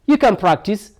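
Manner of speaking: ordinary talk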